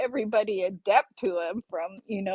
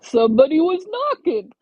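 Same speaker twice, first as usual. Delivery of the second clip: louder, higher protest in imitation; raised-voice mock self-defence; mock defensive grandpa; 5 semitones higher, 6 dB louder than usual. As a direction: plead innocence as a retold grandpa. Grandpa defends himself, retold.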